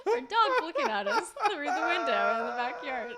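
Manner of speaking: words breaking into real laughter; laughing. Real, not acted. Cohost distracted by dog at window, laughing hard. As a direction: laugh through it, can't hold it together.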